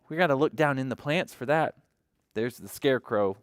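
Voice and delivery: ordinary delivery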